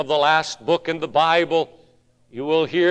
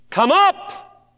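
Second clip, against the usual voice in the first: high, loud call upward; the Lord calling the church up; ringing call; 12 semitones higher, 4 dB louder than usual. Acted. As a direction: call it out like a summons. The Lord's call.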